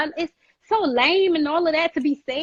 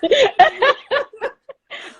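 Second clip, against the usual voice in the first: 1 burst of laughter; 11 semitones higher, 6 dB louder than usual. Real, not acted. Laughs after being praised.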